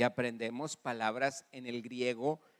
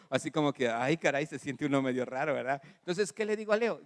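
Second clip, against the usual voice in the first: normal talking voice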